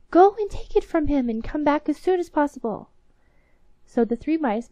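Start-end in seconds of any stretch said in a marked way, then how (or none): 0.1-2.9 commanding queen. Acted. Queen orders the mice.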